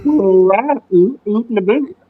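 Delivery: mock Canadian accent. Joke Canadian bit.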